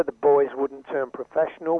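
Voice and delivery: ordinary delivery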